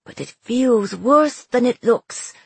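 slow, strained through pain. Acted. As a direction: push the words out through the hurt. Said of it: "hissed through his teeth".

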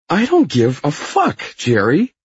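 loud, high whining protest; whiny Hyde. A narrator's reading. Fired man protesting into the phone, high and whiny. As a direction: protest indignantly in a high whine. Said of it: "Hyde is protesting into the phone".

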